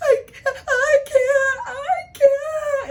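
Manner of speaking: very high, loud hammed-up sobbing; imitated crying. Daughter in labor answers her mother, imitated crying. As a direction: wail it as a mock panicked cry. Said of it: "like, crying, right?".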